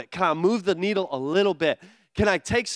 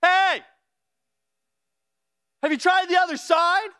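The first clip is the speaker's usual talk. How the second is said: lightly voiced Jesus calling; 11 semitones higher, 4 dB louder than usual.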